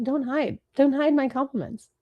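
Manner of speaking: ordinary talk, nothing marked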